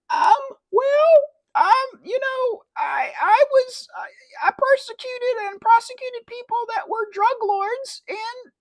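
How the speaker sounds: very high, loud, halting impression, dissolving into cackles; hammed-up high-pitched dodging politician; mock politician's voice. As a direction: stammer evasively in a shrill put-on falsetto, then laugh absurdly. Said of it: "my voice is a little weird there".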